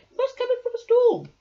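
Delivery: high, loud and fast urging; loud urgent rabbit voice. A narrator's reading. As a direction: hurry him inside. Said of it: "yelled Rabbit".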